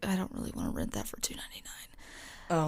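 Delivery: voiceless whisper of a private thought. Retold or imitated. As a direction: whisper it like a secret. Quoting her own thought.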